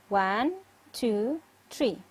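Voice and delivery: "One, two, three" with a pause after "One"; counting out beats. Shown as a demonstration. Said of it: "How many syllables".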